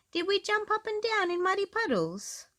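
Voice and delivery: put-on high, eager piggy child; excited little girl; high Peppa voice. A narrator's reading. Peppa asks Mummy about muddy puddles.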